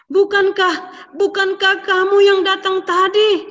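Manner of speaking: high, loud startled question; startled gasp. A narrator's reading. Old father realising he was tricked, shocked and high. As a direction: jolt up in shock and disbelief. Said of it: "Papanya kaget".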